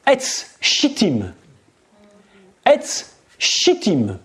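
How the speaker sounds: "etz shittim" said slowly in Hebrew, each syllable spelled out; Hebrew words. Shown as a demonstration. Preacher teaching the Hebrew for acacia wood, slow and careful. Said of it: "disons-le en hébreu".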